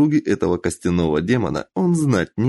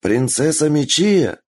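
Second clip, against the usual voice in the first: raised reassuring voice; 7 semitones higher than usual. Acted. Бенджиро answers loudly.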